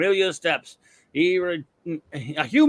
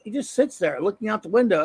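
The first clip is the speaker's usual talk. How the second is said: ordinary delivery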